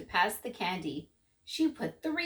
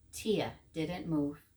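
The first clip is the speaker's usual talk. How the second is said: slow, soft, low narration; slow, low stillness; 5 semitones lower, 4 dB quieter, slower than usual. A narrator's reading. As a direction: draw it out to show stillness.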